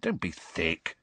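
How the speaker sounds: low, quiet firmness; firm. Acted. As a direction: put a friend straight, softly. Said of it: "said Harry firmly".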